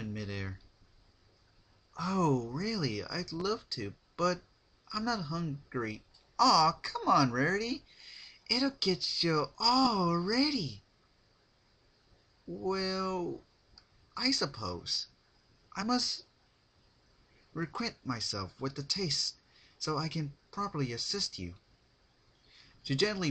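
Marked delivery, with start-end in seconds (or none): 2.0-6.0 higher, prim refusal; lightly voiced refined pony declining; polite Rarity voice. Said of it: "Rarity gently lifted one up". 6.4-10.8 high, loud, drawn-out pleading; high bubbly pony coaxing a friend; coaxing Pinkie voice. Acted. 12.5-21.5 higher, prim refusal; lightly voiced refined pony declining; polite Rarity voice. Rarity giving in.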